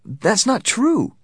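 high, loud, slow protest. A narrator's reading. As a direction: cry out against a lie. Stanley protests the warden's lie.

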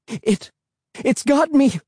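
high, strained, terrified cry; panicked cry, voice tight with fear; tight trembling voice. Acted. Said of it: "I cried in a tight, trembling voice".

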